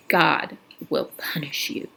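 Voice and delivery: low, slow, sinister whisper; old woman whisper. Acted. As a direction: hiss a curse from an old woman. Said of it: "and whispered".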